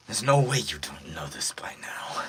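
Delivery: whispery wounded villain threat; breathy battered villain; beat-up villain. Acted. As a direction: force the words out on breath. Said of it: "'cause he's beat up".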